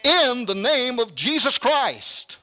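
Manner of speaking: ringing preacher's declaration; emphatic preaching. Real, not acted. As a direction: proclaim it like a pulpit climax. Preacher's climax at full force.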